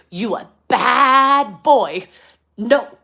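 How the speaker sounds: put-on very slow, loud, high little-girl scold; toddler scolding. Mother imitating toddler scolding the balloon thief, slow and loud. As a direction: wag a finger at a big boy and drawl it out. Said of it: "she points at him and goes".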